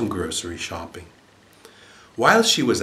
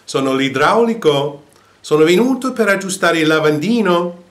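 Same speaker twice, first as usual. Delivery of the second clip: higher, louder workman's voice; plumber at the door; 7 semitones higher, 7 dB louder than usual. A narrator's reading. Plumber answering the parrot, raised voice. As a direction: announce yourself at the door. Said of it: "E l'idraulico rispose".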